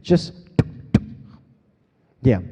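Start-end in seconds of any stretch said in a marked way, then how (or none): 0.6-1.0 percussive double T without voice; two kick-drum hits made with T; repeated punchy T sounds; beatbox kick sound. Shown as a demonstration. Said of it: "Instead, you make the sound".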